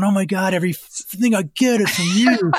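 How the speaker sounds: a hint of a grateful puppy gushing; dog's adoring voice. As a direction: owner lightly voicing his dog's adoration. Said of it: "So your dog is going".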